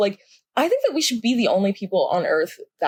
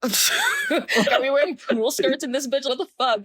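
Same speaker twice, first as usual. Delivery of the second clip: laughing incredulously; 5 semitones higher than usual. Real, not acted. Laughing at absurd school stereotype.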